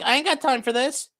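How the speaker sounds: mocking impression. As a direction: toss it off like a lazy boss brushing them off. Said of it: "He's like".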